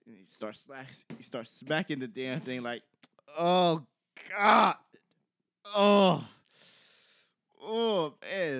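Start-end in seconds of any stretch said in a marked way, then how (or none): 3.4-8.6 hammed-up groans of a man in pain; mock pained moaning. Friend retelling a bathroom guy's agony, mock moaning. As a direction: groan it out like peeing hurts.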